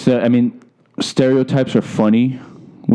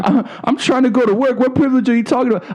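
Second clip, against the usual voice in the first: pitched-up exasperated comeback; indignant retort; 8 semitones higher than usual. Retold or imitated. Repeating his comeback for his laughing friend, exasperated. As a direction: sound fed up and incredulous. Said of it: "That's even better".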